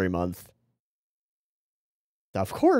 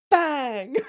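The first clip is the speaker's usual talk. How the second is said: high mock battle cry. Mimicking character's attack cry.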